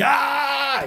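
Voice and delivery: high soft cry of pain; mock pain cry. Seiko hit by a dropkick, high mock cry. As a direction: let out a thin cry of pain. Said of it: "Seiko crie".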